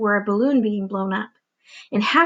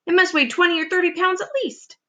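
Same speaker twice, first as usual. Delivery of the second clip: high, fretful aunt; aunt worrying the peach will fall; fussy aunt voice; 8 semitones higher than usual. Acted. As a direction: warn like a nervous old aunt.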